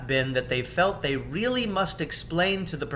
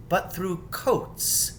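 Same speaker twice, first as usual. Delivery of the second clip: ordinary delivery, nothing marked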